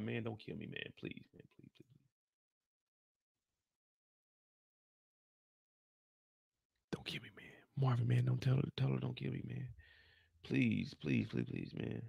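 desperate whispered pleading, fading away; begging for my life. Retold or imitated. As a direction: beg for your life in a broken whisper. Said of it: "Lulu's begging for his life".

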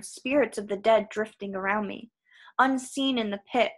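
ordinary delivery, nothing marked

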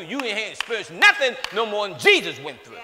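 usual speaking voice

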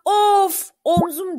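high, drawn-out "ow" of pain; pained cry. A narrator's reading. Okan lies hurt rubbing his shoulder, crying out in pain. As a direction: groan it out as if hurt, mildly acted.

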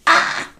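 short loud shouted "Ah"; demo shout. Shown as a demonstration. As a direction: belt out a quick "Ah!" to show shouting. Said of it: "Shout is like".

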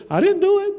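high, squeaky denial; Sarah protesting her innocence to God; Sarah denying. Acted. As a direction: pitch way up, like a caught woman. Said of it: "And Sarah says".

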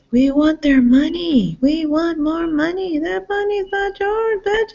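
high, slow sneering mock voice; mocking greedy voice. Mocking the bastards who kill innocents, high sneering voice.

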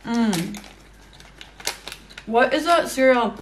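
chewing while speaking; muffled through closed lips; mouth full. Real, not acted. Seen 2: Lips pursed, cheeks moving, hand at mouth while chewing chocolate.

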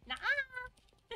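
high squeak of refusal; squeaky high nuh-uh. Acted. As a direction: jump way up, squeaky.

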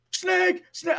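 high, loud warning yell; shouted callout. Retold or imitated. Teammate warning of a player on the snake, loud high shout. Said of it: "I hear Brando go".

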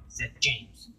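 usual speaking voice

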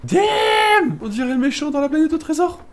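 crie fort puis commente, aigu; s'emballe devant le boss; cri excité. Real, not acted. Découvre un boss en jeu. (cri excité)

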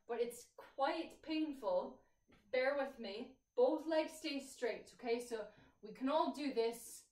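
fitness instructor. Real, not acted. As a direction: talk the class through each stretch. Leads a stretching class.

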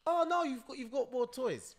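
put-on high, loud kid's exclamation; high-pitched imitation of a surprised kid; voiced child. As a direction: mimic a child's big discovery. Acts out child's discovery in bag.

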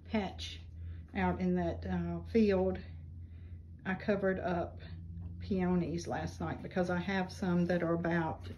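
ordinary talk, nothing marked

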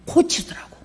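whispered aside. Real, not acted. Preacher confiding the punchline, whispered. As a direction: drop to a breathless whisper.

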